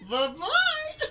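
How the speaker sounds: very high, squeaky taunting farewell; mocking bye-bye. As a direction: wave them off in a cartoon voice.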